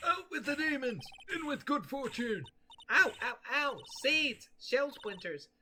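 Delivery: put-on cranky old man. Old man throws seeds at Maya, yelling then yelping. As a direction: hurl the seeds, then yelp in pain.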